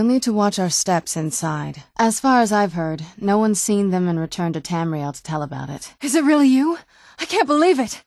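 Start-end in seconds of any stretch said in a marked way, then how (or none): 6.0-8.0 astonished reunion. Acted. Reunion with long-lost mother.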